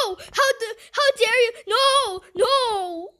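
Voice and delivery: high, loud protest; indignant outburst. Real, not acted. Player protesting at the game, indignant shouting. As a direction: shout it as if personally offended. Scolds game after scare.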